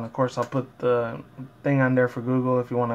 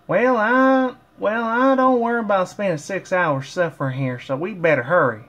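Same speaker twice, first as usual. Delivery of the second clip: much higher, louder put-on pony voice; Applejack voice; 6 semitones higher, 3 dB louder than usual. Fan voicing Applejack urging friends on, high and loud. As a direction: hurry them along in a high loud cartoon voice. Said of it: "Applejack said".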